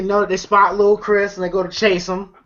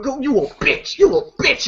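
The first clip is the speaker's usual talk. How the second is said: high-pitched imitation of a taunt; hammed-up, high name-calling; mock taunting; 7 semitones higher than usual. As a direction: jeer it up high, playfully retelling. Retelling Smokey taunting Craig.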